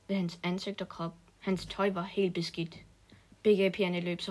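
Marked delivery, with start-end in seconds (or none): none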